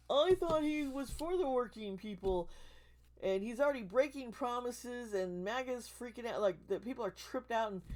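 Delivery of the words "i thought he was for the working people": loud, high mock-naive voter; mocking imitation. Quoting people who voted for Trump, high mocking imitation.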